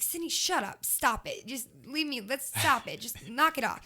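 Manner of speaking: mock exasperated [name]. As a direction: brush off a gushing fan, teasing. [name] scolding her fangirling.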